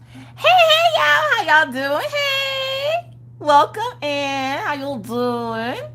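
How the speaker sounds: drawn-out showman hello; show-host greeting. Acted. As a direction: belt the welcome like an opening act. Signature stream greeting.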